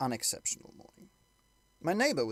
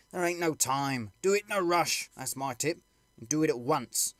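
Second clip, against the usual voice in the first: urgent soldier; 4 semitones higher, 4 dB louder than usual. Acted. Soldier urging a rush.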